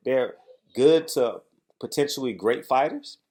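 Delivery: ordinary delivery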